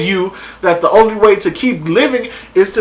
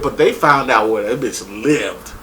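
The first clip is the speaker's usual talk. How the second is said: ordinary delivery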